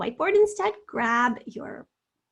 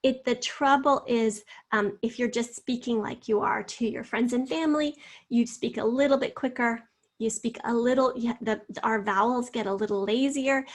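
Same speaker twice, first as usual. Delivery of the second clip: ordinary delivery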